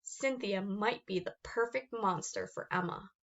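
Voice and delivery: plain reading voice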